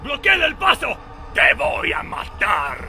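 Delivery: high, fast menacing yell; angry shout. Acted. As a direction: threaten to kill. Villager threatening the intruder.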